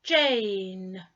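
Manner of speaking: "Jane" with a long, held A sound and a silent E. Shown as a demonstration. Teacher modelling "Jane" to learners, long A clearly. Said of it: "one vowel sound, A".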